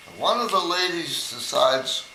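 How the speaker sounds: usual speaking voice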